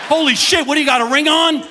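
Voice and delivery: high, loud mock alarm; shocked outburst. Patient shocked by the doctor's finger, loud and high. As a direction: blurt it out in shock.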